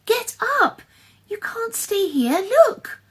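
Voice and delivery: very high, urgent cries; frantic high-pitched pleading; panicked wail. A narrator's reading. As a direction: wail it desperately, ending on a loud, high cry. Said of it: "she wailed".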